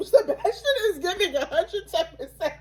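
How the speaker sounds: high-pitched, laughing exclamation; laughing disbelief. Retold or imitated. Friend retelling her reaction to the choice, laughing. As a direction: squeal it through laughter, pitch way up. Amused quote of own reaction.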